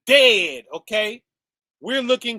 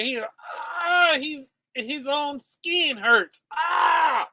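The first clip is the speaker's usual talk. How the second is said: mock anguish; 6 semitones higher, much slower than usual. Mocking the proud man's pain.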